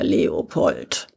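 king summoning the hero in a low hiss; low hissed name. Acted. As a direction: growl the name under your breath. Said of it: "zischte der König".

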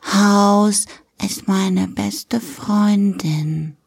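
put-on very slow, childlike declaration; little daughter, each word stretched out; little girl confiding. Daughter tells Papi about best friend, slow childlike sincerity.

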